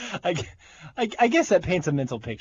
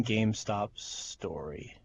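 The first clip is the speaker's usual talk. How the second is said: teasing whisper; 7 semitones lower, 10 dB quieter, much slower than usual. Acted. Teasing the upcoming news story.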